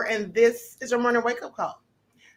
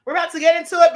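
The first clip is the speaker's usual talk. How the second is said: big louder announcement; louder hype call; 3 semitones higher, 7 dB louder, faster than usual. Real, not acted. Repeats the line to build hype.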